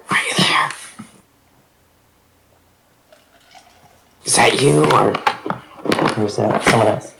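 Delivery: hushed, whispered questions. Acted. Checking whether a hologram is someone known, whispering. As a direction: whisper it cautiously. Secretly addressing a hologram in character.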